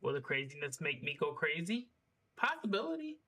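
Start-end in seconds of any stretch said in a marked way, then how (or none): 2.3-3.2 squeaky high joke voice. Real, not acted. Jokey answer to his own question.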